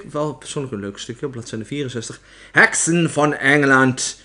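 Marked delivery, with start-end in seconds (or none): 2.5-4.2 loud, slow proclamation; opperheks shouting. A narrator's reading. Opperheks addressing the witches of England, shouting. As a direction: call the hall to attention with a drawn-out shout. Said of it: "schreeuwde de opperheks".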